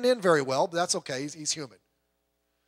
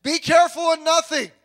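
preached quote; 9 semitones higher, 8 dB louder than usual. Retold or imitated. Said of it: "Tells us".

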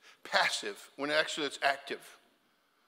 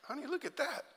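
high, soft incredulous aside to wife; 9 semitones higher, 8 dB quieter than usual. Retold or imitated. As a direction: nudge your wife in disbelief. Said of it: "I'm thinking".

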